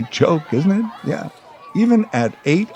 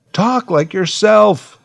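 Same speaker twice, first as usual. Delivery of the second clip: louder, slower, higher scolding; exasperated parent; 8 semitones higher, 4 dB louder, slower than usual. Retold or imitated. Parents scolding their son, firm and exasperated. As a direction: lay down the rule firmly.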